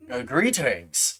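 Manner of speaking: low, slow, subdued dwarf voice; lightly voiced dwarf, softly and slowly; dwarf greeting. Dwarf looks over at the newcomer, a subdued greeting. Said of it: "he looks over at you and he's like".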